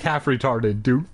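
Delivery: ordinary delivery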